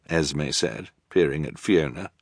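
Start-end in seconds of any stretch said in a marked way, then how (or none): none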